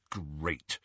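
low, soft grumble; grudging, sour one-word reply; irritable. A narrator's reading. Ron reacting to the locket plan, irritably. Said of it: "said Ron irritably".

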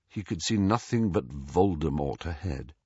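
ordinary delivery

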